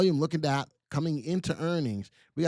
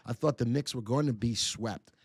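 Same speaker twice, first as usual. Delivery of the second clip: usual speaking voice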